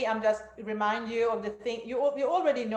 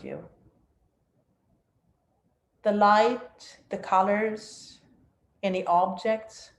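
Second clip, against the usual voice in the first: guided meditation; 4 dB louder than usual. Acted. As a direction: guide them down into stillness.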